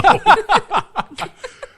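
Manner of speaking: high burst of real laughter; loud laugh. Real, not acted. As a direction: break into a big laugh. Bursts out laughing at own joke.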